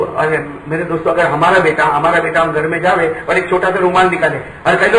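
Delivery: usual speaking voice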